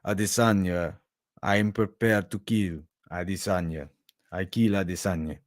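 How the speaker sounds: put-on low-pitched Brazilian-accented English; Pereira's Brazilian accent. Fighter threatening to kill Adesanya, low Brazilian accent. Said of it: "I'll do it in English".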